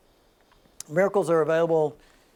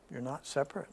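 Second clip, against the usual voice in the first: much softer and lower echo; hushed, tender reassurance; soft repeat; 4 semitones lower, 12 dB quieter than usual. Real, not acted. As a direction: say it again gently. Softer repeat of same line.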